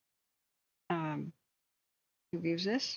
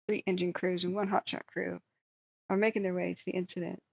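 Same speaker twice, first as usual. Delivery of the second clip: usual speaking voice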